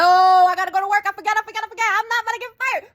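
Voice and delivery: suddenly remember work, frantic; panicked. Retold or imitated. Panicking about being late for work.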